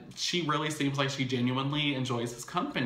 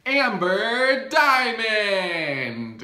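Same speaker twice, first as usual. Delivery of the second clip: drawn out very slowly, much higher and louder; dramatic reveal; 10 semitones higher, 8 dB louder, much slower than usual. Acted. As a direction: announce her like a game-show host unveiling a villain. Dramatic reveal of the side piece.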